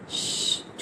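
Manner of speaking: soft drawn "shh"; quiet shush. A narrator's reading. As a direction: hush them with a long sibilant. Hushing her partner on the phone.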